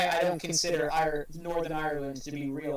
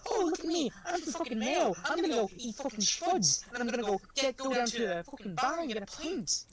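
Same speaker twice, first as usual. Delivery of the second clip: high mock Irish accent; hammed-up Irish caricature, pitched way up; 8 semitones higher than usual. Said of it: "I don't have the stereotypical".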